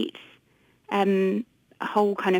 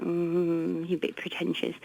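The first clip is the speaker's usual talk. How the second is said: mock disapproval; 3 semitones lower, 7 dB quieter than usual. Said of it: "we're like".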